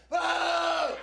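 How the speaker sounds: high, loud single jeer; one big mocking crowd groan. Comic voicing the crowd's jeer at his opener, high and loud.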